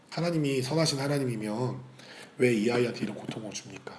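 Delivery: ordinary talk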